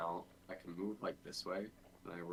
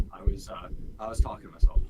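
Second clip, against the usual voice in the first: loud; 17 dB louder than usual. Real, not acted. Repeating it louder for returning friend.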